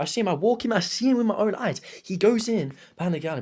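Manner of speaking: worked up, swearing he witnessed it himself; excited. Real, not acted. Telling friends about Joel behind the counter, excited. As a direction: pitch jumps way up, eager to insist he saw it. Excited storyteller stressing eyewitness.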